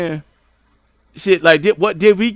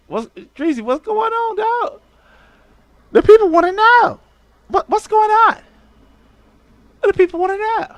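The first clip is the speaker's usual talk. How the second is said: much higher, squeaky mock outrage; mock high-pitched demand. Streamer mocking Tracy in a high voice. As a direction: squeal it like a nosy gossip.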